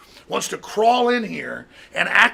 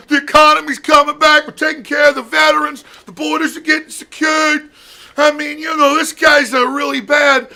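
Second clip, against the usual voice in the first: much higher, loud shouting parody; mock Deutsch rant; 8 semitones higher, 6 dB louder than usual. Mocking Deutsch calling Trump Russian agent, high shouted parody. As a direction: shriek it like a panicked pundit.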